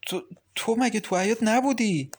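startled and quiet. Acted. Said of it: "خشکش زد و به آرامی گفت".

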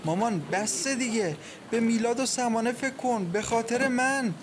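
high, urgent plea to a mother; imploring. A narrator's reading. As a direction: beg her to stop grieving. Daughter implores grieving mother.

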